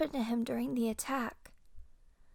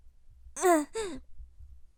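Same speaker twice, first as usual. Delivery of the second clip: sheepish laugh; 4 semitones higher than usual. Acted. Laughs at her own weakness.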